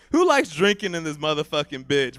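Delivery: ordinary talk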